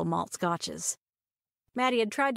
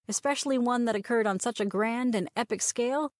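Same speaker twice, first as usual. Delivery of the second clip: plain reading voice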